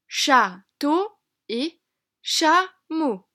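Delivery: Château et chameau said very slowly, syllable by syllable; slow syllable demo. Shown as a demonstration. Teacher showing children the shared syllable, very slow. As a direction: stretch Château and chameau so each syllable stands out. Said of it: "commencent par la même syllabe".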